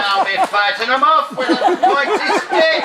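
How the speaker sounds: high, laughing brag; cheeky boast. Retold or imitated. Retold cheeky retort.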